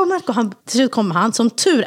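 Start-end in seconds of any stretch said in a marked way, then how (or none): 0.0-0.3 high calls of the name; calling Marco. Retold or imitated. Trapped wife calls her husband, high calling. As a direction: call him through the door. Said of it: "jag bara".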